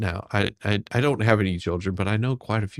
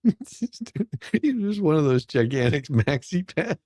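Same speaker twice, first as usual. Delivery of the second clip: real laughter, words pitched high and dragged out; cracking up; 7 semitones higher, slower than usual. Real, not acted. Host reacting to a joke about maxi pads, cracking up. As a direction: struggle to speak through laughter.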